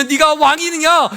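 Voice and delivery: high, loud, drawn-out jeer; preacher's loud imitation of scoffers; mocking taunt. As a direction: throw it out slowly as a mocking challenge. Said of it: "조롱할 것입니다".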